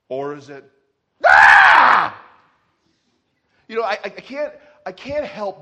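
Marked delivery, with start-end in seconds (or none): 1.3-2.2 sudden loud yell of alarm; big mock-terror holler; panicked scream. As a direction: burst out in a hammed-up scream. Mimes panic instead of trusting God.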